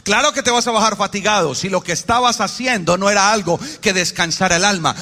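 usual speaking voice

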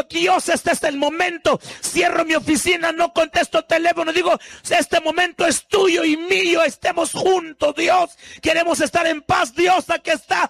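preacher shouting his prayer; fervent preaching. Acted. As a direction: cry out to God with passion. Retold prayer, shouted sermon.

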